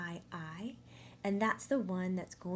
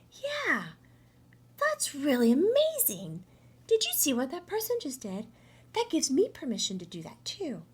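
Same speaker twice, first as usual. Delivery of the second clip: impressed onlookers; 7 semitones higher, 5 dB louder than usual. Retold or imitated.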